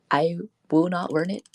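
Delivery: ordinary talk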